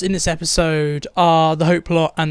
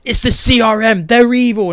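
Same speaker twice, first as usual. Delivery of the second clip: louder, higher hammed-up declaration; mocking Iris; 5 semitones higher, 5 dB louder than usual. Reviewer mocking how fast Iris solves it, loud hammed-up proclamation. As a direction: proclaim it like a silly revelation. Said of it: "you're like".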